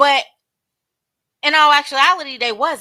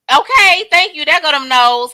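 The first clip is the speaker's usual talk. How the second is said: excited agreement; 4 semitones higher, 6 dB louder than usual. Real, not acted. Reacts to chat answers.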